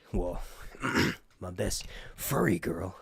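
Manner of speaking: ordinary delivery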